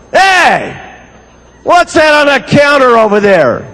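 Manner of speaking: a hint of a suspicious husband calling over; mild mock husband; gruff husband. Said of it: "he looks over on the counter and he goes".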